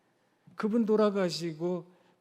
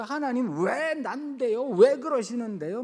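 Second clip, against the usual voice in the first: 흉내 낸 외침; 4 semitones higher, 3 dB louder than usual. Retold or imitated. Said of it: "막 소리도 지르고 그러셨대요".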